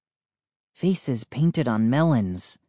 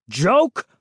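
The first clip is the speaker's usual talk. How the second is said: higher, indignant one-word challenge; growled; 6 semitones higher, 4 dB louder than usual. A narrator's reading. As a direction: throw the word back angrily. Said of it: "Mister McCall growled".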